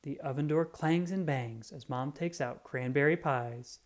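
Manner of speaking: ordinary delivery, nothing marked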